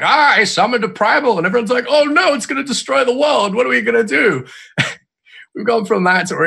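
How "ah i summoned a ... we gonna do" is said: high, loud mock panic. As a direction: squeal it like everyone's doomed. Acting out panicked old party.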